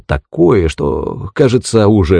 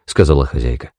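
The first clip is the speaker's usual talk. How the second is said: ordinary delivery, nothing marked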